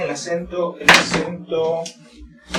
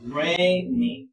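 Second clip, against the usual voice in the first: rainy slowly split, long stressed RAI then NY; first-syllable stress; 3 semitones higher than usual. Shown as a demonstration. Teacher models the word slowly, stress on RAI in rainy. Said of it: "Mayor fuerza de voz en la primera sílaba".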